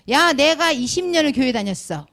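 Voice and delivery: smug churchgoer bragging to a friend; boastful brag. Retold or imitated. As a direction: brag down at a newcomer. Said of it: "이렇게 말할 수가 있어요".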